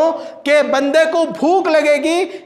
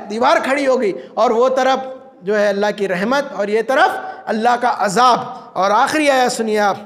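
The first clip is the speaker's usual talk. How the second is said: ordinary talk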